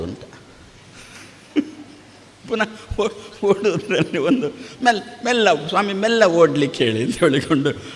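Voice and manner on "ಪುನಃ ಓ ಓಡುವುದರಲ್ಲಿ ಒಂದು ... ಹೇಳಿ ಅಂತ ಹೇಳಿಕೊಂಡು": real chuckle running through the words; amused, laughing retelling; laughing while talking. Real, not acted. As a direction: tell it through your own laughter. Amused by his own anecdote.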